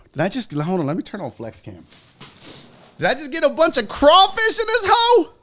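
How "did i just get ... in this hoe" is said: high-pitched squeal of amazement; overjoyed at the surprise food; thrilled disbelief. Real, not acted. Discovering what was delivered.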